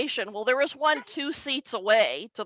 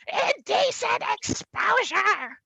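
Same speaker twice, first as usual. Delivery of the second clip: Indecent exposure much higher and slow; outraged squeal; 10 semitones higher than usual. Retold or imitated. Dad repeating Indecent exposure, high and slow.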